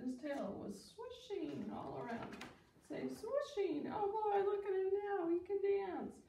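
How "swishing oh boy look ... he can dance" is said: high, loud cry of delight; excited cheering. Acted. Animals cheering as Gerald dances, thrilled and high.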